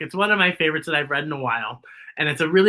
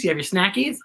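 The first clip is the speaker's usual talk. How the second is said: ordinary delivery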